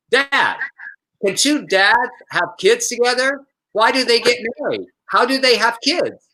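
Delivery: a hint of a small child asking dad; mock kid asking. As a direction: play the curious child in the tweet. Child voice in quoted tweet.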